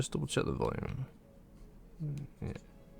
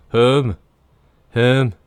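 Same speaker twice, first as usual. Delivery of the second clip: "Home" as "hurm", loud, higher, in mock northern English; northern English accent; 6 semitones higher, 9 dB louder than usual. Streamer mocking grating northern accents, "Home" said as northern English "hurm". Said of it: "where they can't say home, or they go".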